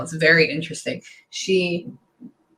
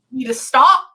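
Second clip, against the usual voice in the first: mock scolding; 7 semitones higher, 4 dB louder than usual. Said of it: "she laughs says".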